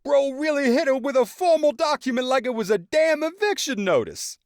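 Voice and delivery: very high, loud mock outrage; dramatic reenactment. A narrator's reading. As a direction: ham up the reenactment. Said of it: "Tyrone began a dramatic reenactment".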